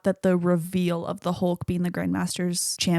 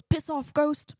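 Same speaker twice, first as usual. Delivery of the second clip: higher-pitched attempt at a New Zealand accent; imitation New Zealand accent, pitched up; 8 semitones higher, slower than usual. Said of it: "work on my New Zealand accent".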